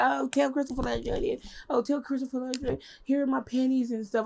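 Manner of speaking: high, swooning fan voices; mock flirty women. Women sending panties to Christopher Lovejoy, high and giddy. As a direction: gush like giddy groupies. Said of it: "they were like".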